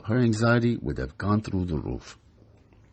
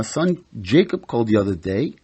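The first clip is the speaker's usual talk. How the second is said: ordinary delivery, nothing marked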